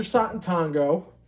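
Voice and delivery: "Satan Tango" as SAH-tun TAHN-go; foreign-style pronunciation. Real, not acted. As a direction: switch to AH vowels in "Satan Tango". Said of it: "see how I keep going back and forth".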